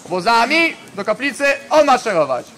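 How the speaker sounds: loud parade-ground command; drill sergeant. Real, not acted. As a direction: bark the dismissal order. Leader dismisses the formation.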